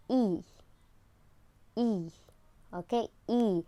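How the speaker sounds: E spread wide from side to side; long E sound. Shown as a demonstration. Teacher showing pupils the long E sound. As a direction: smile on E. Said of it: "has the sound of".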